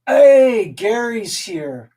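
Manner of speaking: ordinary talk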